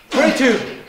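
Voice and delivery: calling out reps; loud rep counting. Real, not acted. Counting reps aloud at gym.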